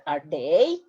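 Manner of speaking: "Are they?" rising at the end like a question; question tune. Shown as a demonstration. Teacher showing students how to turn it into a question. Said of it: "Like in a question".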